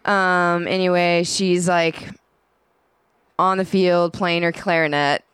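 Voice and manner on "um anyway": ordinary talk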